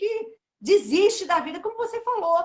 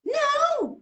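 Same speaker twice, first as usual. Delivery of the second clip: drawn-out, high, indignant retold "no"; almost quarrelling; 10 semitones higher, 4 dB louder than usual. Bereaved mother answers the psychologist, almost quarrelling. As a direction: push back as if picking a fight. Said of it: "quase que brigando".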